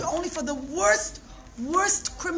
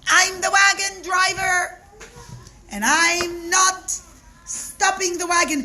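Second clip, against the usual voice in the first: higher, louder, slow defiant driver; stubborn refusal; 7 semitones higher, 5 dB louder than usual. Acted. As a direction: plant your feet and refuse.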